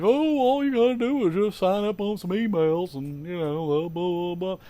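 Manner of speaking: dismissive know-it-all voice; mocking naysayer. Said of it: "naysayers out there that are like".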